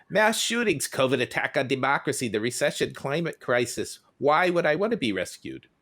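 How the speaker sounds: hammed-up exasperated cartoon voice; castaway cartoon character. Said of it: "Was that your French accent?".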